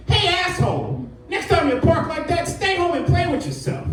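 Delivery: high mocking voice, nagging; mock angry note. Citizen's windshield note to the careless parker, high and snippy. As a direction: read the note like a snippy busybody. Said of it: "put a note on my windshield".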